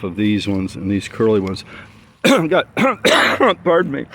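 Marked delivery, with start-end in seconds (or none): 2.2-4.1 sudden high coughs mid-sentence; coughing fit. Real, not acted. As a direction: cough hard, then excuse yourself. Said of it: "I'm choking on my plants".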